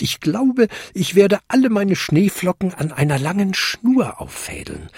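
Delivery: lightly voiced kobold, planning to himself; kleiner Kobold im Selbstgespräch. A narrator's reading. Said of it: "sagte er zu sich selbst". (leicht gespielter Kobold, höhere Stimme, zu sich selbst)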